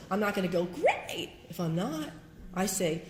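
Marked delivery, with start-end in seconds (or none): 0.7-1.3 a much higher, sing-song "Great"; fake cheerful great. Shown as a demonstration. She mocks the fake answer to a friend's greeting, high false cheer. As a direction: chirp it like a false smile.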